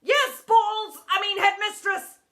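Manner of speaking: loud, high, flustered reply; calling back. A narrator's reading. Teacher answers headmistress from the playground, flustered shout. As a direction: shout back, catching the slip. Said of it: "he called back from the playground".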